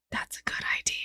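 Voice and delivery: gasp, then a breathy whisper. Acted. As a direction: whisper it in awe. Excited whispered reaction.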